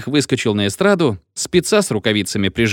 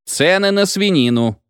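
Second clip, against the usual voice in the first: high-pitched insistent cry; mild shout; 8 semitones higher than usual. A narrator's reading. Speaker answers heckler about his report, mild shout. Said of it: "закричал докладчик".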